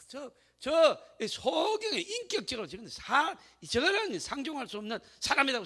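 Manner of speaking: mock disdain. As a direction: imitate scornful onlookers in a high-pitched voice. Onlookers disdain the blind man. (업신여기는 군중 흉내)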